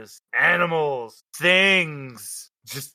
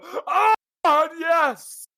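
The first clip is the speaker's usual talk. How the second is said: mock ecstatic cry; 11 semitones higher, much slower than usual. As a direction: overplay a cry of pleasure. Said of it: "and they just go".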